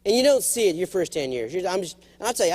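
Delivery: ordinary delivery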